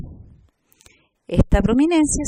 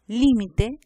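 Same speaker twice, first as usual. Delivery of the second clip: LÍMITE said LI-mi-te, first syllable punched; stress on first syllable. Shown as a demonstration. Teacher tells apart identical words, LÍMITE stressed on LÍ. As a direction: hit the LÍ of LÍMITE. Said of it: "Nos permite diferenciar palabras fonológicamente idénticas".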